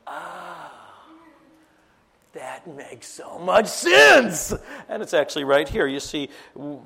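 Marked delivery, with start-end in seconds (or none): 0.1-4.5 slow, loud ah-ha exclamation; sudden realisation. Retold or imitated. Couple realising they are under spiritual attack, loud and slow. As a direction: let the lightbulb go on out loud. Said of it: "We go".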